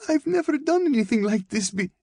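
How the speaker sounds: very high, frantic protest, cut short; frightened girl's high-pitched plea; desperate pleading. A narrator's reading. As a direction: plead in panic until interrupted. Girl pleading, cut off by attacker.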